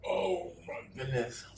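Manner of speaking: strained high gasp after choking; recovering from choking. Real, not acted. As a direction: squeeze it out, still coughing. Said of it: "Almost choked on that".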